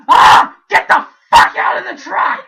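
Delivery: put-on high furious scream at the kids; mom yelling. Mom screaming at kids in her truck, furious. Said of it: "my mom just".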